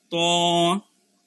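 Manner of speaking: ত drawn out as a heavy "taw"; thick to. Shown as a demonstration. Teacher modelling the letter for students, thick to. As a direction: hold ত full and rounded. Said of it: "একটু মোটা করে পড়লেই ত হয়ে যাবে".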